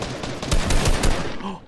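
loud startled gasp; sharp gasp. Real, not acted. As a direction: gasp in shock. Player spots two enemies.

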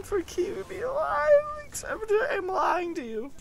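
high, whiny mock crying; fake sobbing. Gamer confessing a lie to teammates, fake sobbing. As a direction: sob it out with playful guilt.

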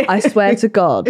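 ordinary talk

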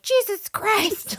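high, slow exasperated groan; high mock disgust. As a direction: recoil in embarrassment. Seeing dad's underwear.

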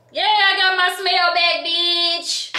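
high, loud gleeful yell; excited shout. Real, not acted. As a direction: crow with sudden delight. Thrilled over a perfume.

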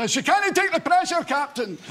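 high, loud Scottish engineer cry; Scottish accent, panicked movie cry. Retold or imitated. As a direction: shout it like the ship's engineer in a movie. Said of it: "that Scottish engineer".

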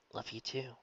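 soft, faltering reply. A narrator's reading. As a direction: let the voice falter. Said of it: "in a faltering voice".

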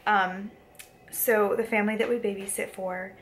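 usual speaking voice

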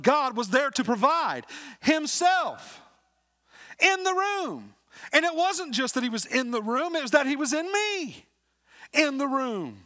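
testimony that swells into fervent preaching; building to full-force preaching. Acted. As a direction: preach it, lifting with every line until it soars. Testimony rises to a preaching peak.

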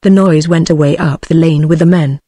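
ordinary delivery, nothing marked

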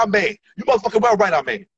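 ordinary delivery

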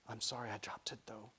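soft, hushed apology; small hushed voice; whispered apology. A narrator's reading. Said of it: "he whispered".

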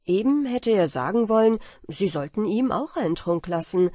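ordinary delivery

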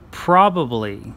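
each word said alone, slowly and clearly; careful slow pronunciation. Shown as a demonstration. Reading a word-list tag to viewers, slow careful pronunciation. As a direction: lay out every word with long pauses. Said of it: "say it nice and slowly".

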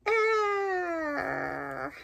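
high, startled yelp of rebirth; hammed-up cry as if being born; playful high shout; mock birth cry.

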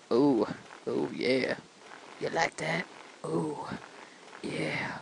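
low, soft suggestive croon; mock seductive. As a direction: drop it low and soft, teasingly. Suggestive joke over game motion.

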